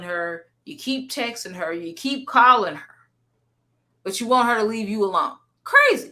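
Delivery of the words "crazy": exclaimed. Real, not acted. Exclaims after replaying clip.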